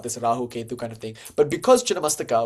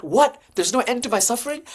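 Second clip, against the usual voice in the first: high-pitched mock outrage; shocked disbelief; 10 semitones higher than usual. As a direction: act out a stunned seeker, much higher. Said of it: "And you might be shocked".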